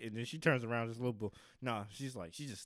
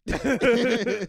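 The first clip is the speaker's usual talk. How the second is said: loud high burst of laughter; 12 semitones higher, 18 dB louder than usual. Real, not acted.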